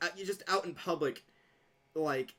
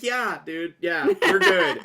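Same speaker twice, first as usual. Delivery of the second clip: loud and slow through laughter; telling the cashier they're cool while laughing; laughing reassurance; 11 dB louder, much slower than usual. Retold or imitated. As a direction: reassure him while cracking up.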